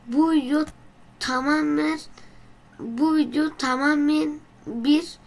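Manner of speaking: very serious voice. Pretend. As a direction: keep a dead straight face and declare it. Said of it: "çok ciddi bir sesle de ki".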